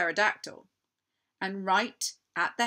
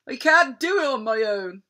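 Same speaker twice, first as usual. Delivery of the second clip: blustering; 5 semitones higher, 10 dB louder than usual. Acted. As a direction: bluster helplessly. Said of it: "blustered Captain Stubble".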